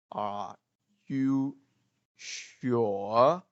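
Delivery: "Are... you... sure?" with long gaps, "sure" rising; word-by-word question. Shown as a demonstration. Teacher breaks down the waiter's question, word by word. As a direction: break it into single words, stretching "sure?" upward. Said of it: "a British way of saying this".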